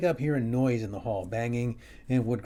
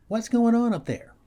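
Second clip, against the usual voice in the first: wife calling up the stairs, higher and louder; mock yell; 9 semitones higher, 6 dB louder than usual. Wife yells up from the bottom of the stairs, loud. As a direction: shout it up to him from below. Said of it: "She yells".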